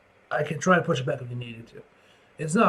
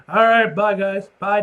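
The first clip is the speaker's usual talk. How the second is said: ordinary talk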